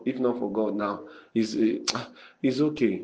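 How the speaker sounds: usual speaking voice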